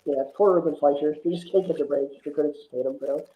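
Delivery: ordinary talk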